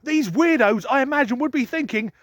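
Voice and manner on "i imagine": ordinary delivery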